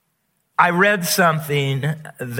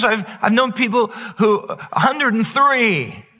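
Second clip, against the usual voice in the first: preaching at full force; 7 semitones higher than usual. Acted. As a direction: drive it home like a preacher.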